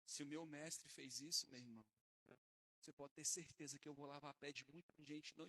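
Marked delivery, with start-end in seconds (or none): none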